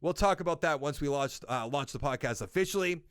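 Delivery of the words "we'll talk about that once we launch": normal talking voice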